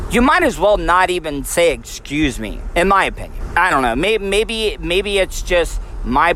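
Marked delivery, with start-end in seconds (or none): 1.7-2.6 softer, lower, snide "Excuse me"; mild mock-rude imitation; like a dickhead. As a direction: sneer it out like a jerk. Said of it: "like a complete dickhead".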